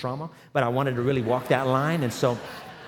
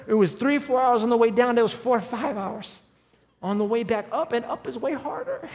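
usual speaking voice

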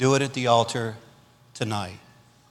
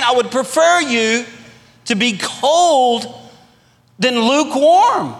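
Jesus' voice; 10 semitones higher, 4 dB louder, slower than usual. Retold or imitated. Preacher voicing Jesus, slowed.

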